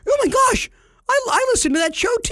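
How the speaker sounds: high squealing fan shout, fast; a fan in a store shrieks on spotting the podcast host; excited fan. Pretend. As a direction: gush like a starstruck listener spotting you. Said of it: "When somebody goes".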